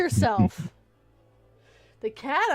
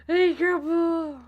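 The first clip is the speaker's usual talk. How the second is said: slow, drawn-out coaxing, louder; coaxing the dog; 4 semitones higher, 12 dB louder, much slower than usual. Real, not acted. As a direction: stretch each word to coax the dog.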